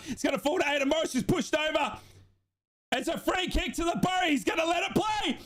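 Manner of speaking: high, pleading, shouting at the screen; frantic commentary. Real, not acted. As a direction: beg them to shoot, voice cracking high. Live watchalong, late free kick tension.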